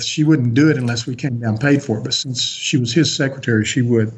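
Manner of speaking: normal talking voice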